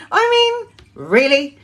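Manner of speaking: squeaky high, laughing disbelief; a stunned, laughing "really?". Real, not acted. Reacting to shocking statistic.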